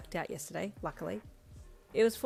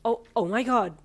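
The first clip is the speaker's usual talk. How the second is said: loud, higher, stunned gasp; 4 semitones higher, 10 dB louder than usual. A narrator's reading. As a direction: stammer it in dawning shock. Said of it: "you gasped".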